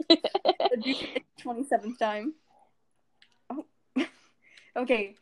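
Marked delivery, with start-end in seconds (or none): none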